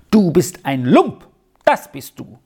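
emphatic, contained anger; angry. A narrator's reading. Boy insulting his friend before turning away, angry. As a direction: fling the insult at him, mildly heated. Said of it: "rief er zornglühend".

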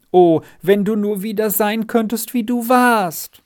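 high-pitched, alarmed warning; frightened pleading. A narrator's reading. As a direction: plead in fright with a friend going astray. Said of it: "in großem Schrecken aus".

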